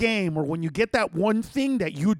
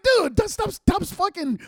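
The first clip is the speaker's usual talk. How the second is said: high-pitched exasperated protest; whiny, high complaint to a teammate; mock exasperation; 11 semitones higher, 3 dB louder than usual. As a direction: imitate a fed-up game partner. Acted exasperation at partner's wrong guess.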